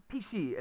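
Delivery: "pishi" for fish, with P instead of F; Konglish pronunciation. Shown as a demonstration. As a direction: add an ee to the end, "pishi". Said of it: "that's a very Konglish".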